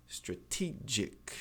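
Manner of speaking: "strategic" slowly, with a crisp T in "te"; stressed true T. Shown as a demonstration. Teacher comparing words of one root, true T. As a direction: draw out "strategic", keeping its T. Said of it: "não mexa".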